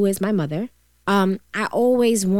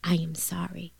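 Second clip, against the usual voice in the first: soft, hushed, tender sorry; whispered apology; 3 semitones lower, 6 dB quieter than usual. Retold or imitated. Mother finally apologizing to her daughter, whispered. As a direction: breathe the apology out softly. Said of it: "She said".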